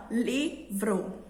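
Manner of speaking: "livro" slowly split as LI... vro; stress on LI. Shown as a demonstration. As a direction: sound out "livro", leaning on the first syllable. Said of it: "entonação foi mais para a sílaba li". ("livro" devagar, sílaba por sílaba, ênfase no LI)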